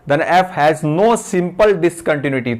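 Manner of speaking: very slow, word by word, for note-taking; slow dictating teacher; slow dictation. Shown as a demonstration. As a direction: read it out slowly while students write. Dictating theorem for notes.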